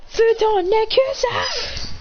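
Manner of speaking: high, slow called-out technique; drawn-out battle cry. Acted. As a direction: stretch out the move name. Casting escape technique again.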